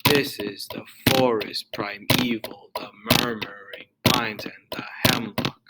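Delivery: slow DUM-da-da beat on "This", "forest", "murmuring", "hemlock"; dactylic stress. Shown as a demonstration. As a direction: stress the first syllable, then two light ones, from "This" to "hemlock". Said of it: "accented followed by two unaccented".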